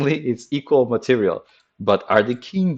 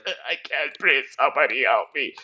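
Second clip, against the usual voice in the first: strangled, higher cry for help from a trapped queen; mock suffocating queen; 4 semitones higher than usual. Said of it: "The queen is like".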